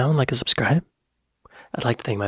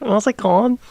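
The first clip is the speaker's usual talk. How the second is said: imitated Scouse accent; 9 semitones higher, 4 dB louder than usual. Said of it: "Can you do Scouse accent?".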